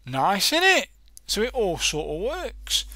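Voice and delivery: bright, loud burst of delight; pleased. Real, not acted. Teacher delighted the alert button works, loud and pleased. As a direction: cheer that the demo works.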